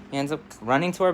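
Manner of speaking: plain reading voice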